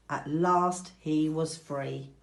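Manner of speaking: ordinary delivery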